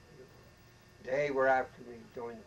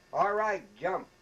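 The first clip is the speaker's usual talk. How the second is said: colonel's order; 5 semitones higher, 8 dB louder, slower than usual. Retold or imitated. Colonel orders recruit to jump.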